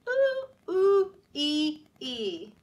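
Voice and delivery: high monkey ooh ooh ee ee; monkey noise. Pretend. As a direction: chatter like a monkey. Book animal sound.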